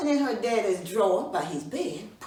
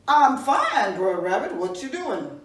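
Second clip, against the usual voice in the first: hearty greeting; 9 dB louder, slower than usual. Acted. Bruh Man hails Rabbit cheerfully.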